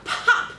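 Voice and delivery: high, sharp popping sound; loud pop. Acted. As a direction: make the button burst. Button popping sound effect.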